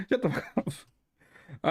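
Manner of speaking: slow, drawn out through laughter; can't stop laughing while telling him off; dissolving into laughs at a friend. Real, not acted. Laughing at the teasing.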